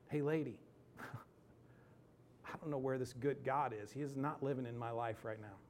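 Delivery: preacher choked up, admitting God felt absent. Real, not acted. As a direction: laugh through the tears. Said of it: "tears start to well up".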